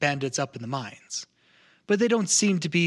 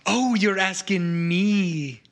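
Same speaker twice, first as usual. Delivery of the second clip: slow, swooping, drawn-out realisation; mock incredulous; 5 semitones higher, 3 dB louder, much slower than usual. Informant to a haggling customer, mock incredulous. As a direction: draw it out high, savouring the cheek of it.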